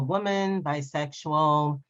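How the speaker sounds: usual speaking voice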